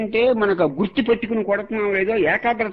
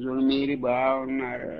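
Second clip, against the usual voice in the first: mock sluggish drawn-out greeting, a touch softer; slow listless doctor; 5 semitones lower, 5 dB quieter than usual. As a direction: imitate a limp, slow bedside greeting. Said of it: "బాగా షోగా నెమ్మదిగా మాట ఉసూరుమంటే". (నెమ్మదిగా, నీరసంగా పలకరింపు అనుకరణ)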